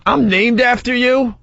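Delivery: high, drawn-out questioning tone, mock awkward; awkward self-introduction. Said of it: "all awkward".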